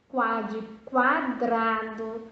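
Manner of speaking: quá de quadrado slowly, the U in quá clearly heard; slow sound demo. Shown as a demonstration. Teacher showing the U sound in quadrado. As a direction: pronounce quadrado so the U shows. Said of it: "preste atenção aí que você consegue escutar o som".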